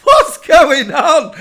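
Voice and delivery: loud and slow, thrown-out question; surprised. Retold or imitated. Seeing her own meme on her timeline, loud surprise. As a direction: blurt it out slowly in disbelief. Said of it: "I was like".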